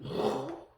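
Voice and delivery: breathy imitated booster whoosh; voiceless whoosh noise; rocket whoosh. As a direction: blow out a burst like a rocket jump.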